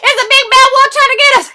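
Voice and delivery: high loud fast panic; terrified pig yelling fast; panicked shouting. Acted. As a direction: blurt it out in terror. Panicked pigs explain.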